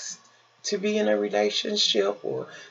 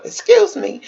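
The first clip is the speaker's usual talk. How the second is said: much higher, louder polite excuse me; lady's voice; 9 dB louder than usual. Retold or imitated. Strange lady at the corner store, high and loud. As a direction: put on the lady's high, sweet voice. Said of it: "She come in and she says".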